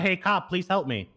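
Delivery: usual speaking voice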